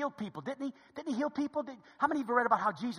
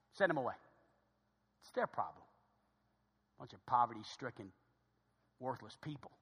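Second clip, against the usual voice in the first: low, soft grumble of disdain; dismissive attitude; 5 semitones lower, 3 dB quieter than usual. Acted. Disciples dismissing the poor crowd to God, dismissive. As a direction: drop it low and write them off. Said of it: "It's the attitude of some Christians".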